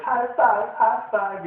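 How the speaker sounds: loud high call of "High five"; imitating a Borat-ish cheer. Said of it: "and people like".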